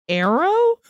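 puzzled arrow. Retold or imitated. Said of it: "I was like".

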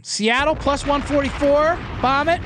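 slow, high, punchy hype on each pick; hyped recap. Real, not acted. Host hyping his betting picks to listeners, slow and punchy. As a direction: sell each bet like a sure thing.

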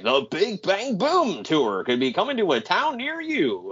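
mock announcer. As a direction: sell it like a cheesy commercial. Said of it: "He's like".

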